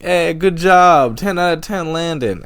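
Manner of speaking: high, loud, drawn-out heckle; mock yelling. People on ski lifts teasing a fallen skier, mock yelling. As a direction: imitate hecklers calling from afar. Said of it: "yelling at me".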